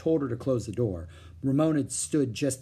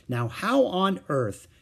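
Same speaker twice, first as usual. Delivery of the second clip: slow, higher puzzled wondering; puzzled mother; 7 semitones higher, 4 dB louder, slower than usual. A narrator's reading. Mother puzzling over the locked door, baffled. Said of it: "began Mother in a puzzled voice".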